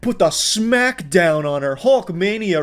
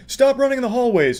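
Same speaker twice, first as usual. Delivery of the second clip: higher, prissy hall monitor voice; 8 semitones higher than usual. Retold or imitated. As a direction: play a fussy hall monitor. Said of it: "like a hall monitor".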